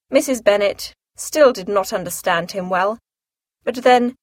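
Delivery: plain reading voice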